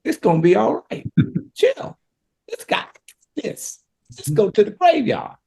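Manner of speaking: high, laughing, casual brush-off; breezy reassurance. Pretend. The speaker pretending to tell Mary to relax, laughing and breezy. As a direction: toss it off lightly, half laughing. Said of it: "I would have said".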